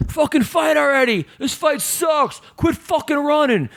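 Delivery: high, drawn-out mock heckle; Nick Diaz yelling. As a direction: imitate an impatient fan yelling from the back. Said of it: "Nick Diaz is yelling this".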